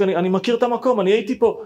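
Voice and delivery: high, loud insistent yell; shouting. Retold or imitated. Narrator shouting back at the pilot, loud. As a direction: yell it, desperate to be believed. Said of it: "ואני צועק".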